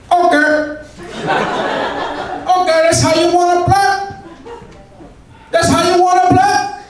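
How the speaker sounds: mock Pacino shouting back, Cuban accent; Al Pacino impression. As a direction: yell it back like Tony Montana. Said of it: "I just Pacino'd right back at him".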